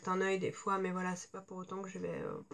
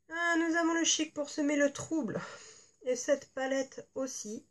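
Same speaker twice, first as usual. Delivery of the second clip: ad read; 3 semitones higher, 4 dB louder than usual. Acted. A beauty vlogger reading the box copy to her viewers, as a sultry ad read. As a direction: sell the palette like a perfume commercial. Reads the packaging copy aloud.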